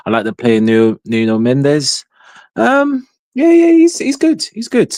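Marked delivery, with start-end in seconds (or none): 2.6-4.9 much higher and louder, grudging; high reluctant praise. Real, not acted. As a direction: squeak out a grudging compliment.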